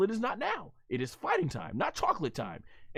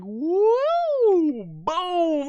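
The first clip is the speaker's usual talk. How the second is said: much higher, louder mock swoosh; whooshing throw sound effect; 13 semitones higher, 6 dB louder than usual. Fan mimics the hero hurling Buu, loud whoosh. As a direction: swing it out like a cartoon throw.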